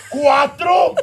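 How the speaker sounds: high, loud imitated mom; mock threatening count; screamed countdown. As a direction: shout the number like a last warning. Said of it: "counting down".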